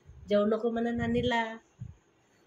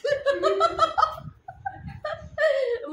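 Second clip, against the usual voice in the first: bursting high-pitched laugh; real amused laughter; 13 semitones higher than usual. Real, not acted. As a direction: mom cracking up at her daughter's answer. Mother amused by child's answer.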